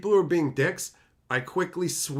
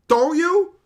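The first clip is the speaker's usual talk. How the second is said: teasing; 13 semitones higher, 9 dB louder than usual. Acted. Teasing repeat, louder.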